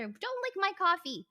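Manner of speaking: high, sudden scolding of the pet; scolding aside. Real, not acted. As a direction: snap a quick high warning at the animal. Aside scolding a pet mid-story.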